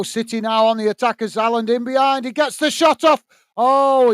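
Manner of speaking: rising excitement as Haaland shoots; excited commentary. Real, not acted. Commentating to chat as Haaland's shot goes wide, excited.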